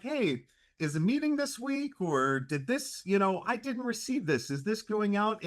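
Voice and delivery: high, bright mock reminder voice; imitating Paula's reminder. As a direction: chirp it like a friendly nudge. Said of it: "it's a kind reminder, a kind nudge".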